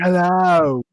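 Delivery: vacant mock greeting; a hint of an absent, spaced-out rocker. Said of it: "like, he's not there".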